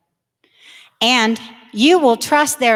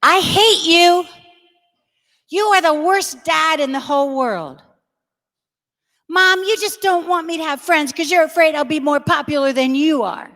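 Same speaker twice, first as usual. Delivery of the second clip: put-on higher, sulky teenage protest at a parent; bratty teen outburst; 5 semitones higher than usual. Daughter telling dad he's the worst, bratty higher whine. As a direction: whine it like a furious fourteen-year-old.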